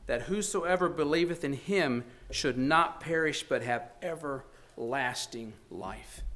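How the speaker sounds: hushed, slow scripture reading; reverent hush. Acted. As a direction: read it as if silenced into awe.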